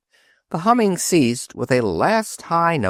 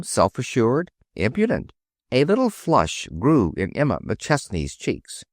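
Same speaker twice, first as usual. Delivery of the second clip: ordinary delivery, nothing marked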